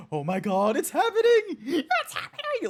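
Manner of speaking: squealing high with laughter; hammed-up narrator shrieking with glee; ecstatic narrator. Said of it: "you have the narrator going".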